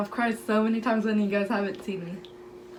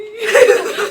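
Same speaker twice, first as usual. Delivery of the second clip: high, loud burst of real laughter; 12 semitones higher, 14 dB louder than usual. Real, not acted. Laughing while telling story.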